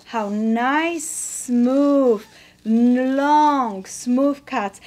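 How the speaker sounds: teacher stressing "smooth" and "long" to show a clean cut; emphasizing smooth cuts. Shown as a demonstration. Quilting teacher to viewers, stressing "smooth" and "long". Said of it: "I'm emphasizing this".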